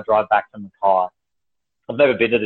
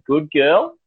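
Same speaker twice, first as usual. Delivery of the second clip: higher-pitched pet praise; praising a dog; 6 semitones higher than usual. Retold or imitated. Owner praising dog after its mess, higher approving voice. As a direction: lift the voice as if rewarding a dog.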